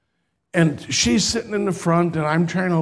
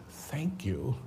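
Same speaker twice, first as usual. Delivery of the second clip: breathy whispered thank you; 5 semitones lower, 15 dB quieter than usual. Retold or imitated. Stroke patient thanks the pastor, whispering. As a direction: barely voice it, grateful and weak. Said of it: "she just said".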